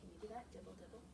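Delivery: lively picture-book reading with big sound words; expressive read-aloud. Acted. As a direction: read it to the kids with full expression.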